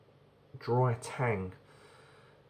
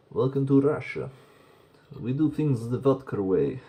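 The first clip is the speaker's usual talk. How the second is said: hammed-up Russian welcome, louder and higher; mock Russian accent; 3 semitones higher, 6 dB louder than usual. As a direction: greet them like a proud Russian host. Mock Russian welcome after Russian label.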